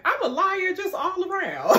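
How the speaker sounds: real laughter in the voice; laughing. Real, not acted. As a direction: confess while cracking up. Laughs at own lying.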